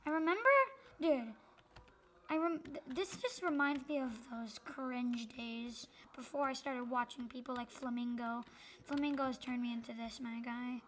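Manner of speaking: usual speaking voice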